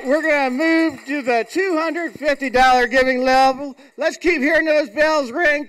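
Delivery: ordinary talk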